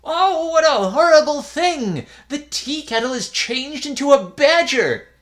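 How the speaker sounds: high, loud horrified cry; frightened cry. A narrator's reading. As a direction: shriek at the sight in horror. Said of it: "He cried out".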